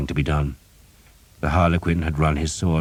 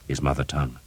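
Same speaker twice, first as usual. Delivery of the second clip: ordinary delivery, nothing marked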